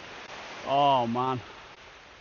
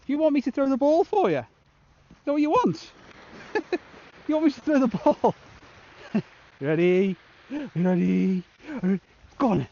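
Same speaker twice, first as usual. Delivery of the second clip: high, louder sing-song pet voice; 9 semitones higher, 4 dB louder than usual. Real, not acted. Angler teasing a beach dog with the ball, high pet voice.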